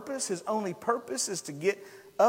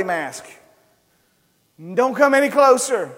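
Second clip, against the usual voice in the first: louder, higher shouts pushing people back; yelled warning; 4 semitones higher, 14 dB louder than usual. Acted. Leper yelling at the approaching multitude, loud. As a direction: yell to drive the crowd off. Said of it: "he's having to yell".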